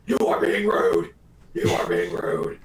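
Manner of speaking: low, loud mock growl; death metal vocals. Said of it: "death metal vocals".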